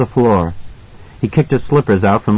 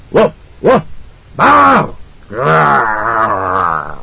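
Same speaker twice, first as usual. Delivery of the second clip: barking then growling like a dog; dog barks and growl; much slower than usual. A narrator's reading. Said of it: "making dog grunts in his throat".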